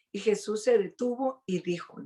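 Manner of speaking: normal talking voice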